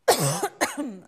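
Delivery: loud hacking cough; real choking cough; loud coughing fit. Real, not acted. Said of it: "I'm really sorry".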